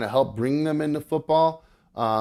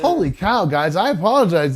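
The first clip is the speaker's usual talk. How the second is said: higher and louder, flustered; flustered exclaim; 6 semitones higher, 8 dB louder than usual. Real, not acted. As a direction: blurt out an apology. Embarrassed by the cat mess.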